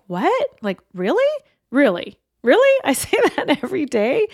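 retold disbelief, high rising questions; flabbergasted. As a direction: re-enact being stunned, voice leaping up. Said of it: "I was flabbergasted looking at him going".

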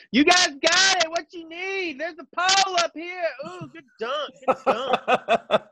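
high, laughing, shouted cheer; mock cheerleader. Imagined dancers cheering players from the arena top, high and laughing. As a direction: whoop it up from the rafters, laughing.

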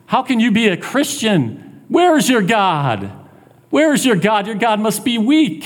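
high-pitched, needling taunt; mocking enemy, pitched well up. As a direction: jeer at a struggling believer. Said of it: "The enemy is taunting him".

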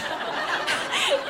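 a burst of high, breathy laughter; light giddy laugh; high giggle. Real, not acted. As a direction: giggle as if caught out. Giggle between diary lines.

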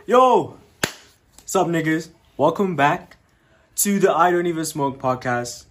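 loud podcast host greeting; big energetic show opener; hype intro. Acted.

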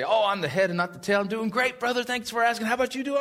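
overly chipper churchgoer; fake cheerful churchgoer.

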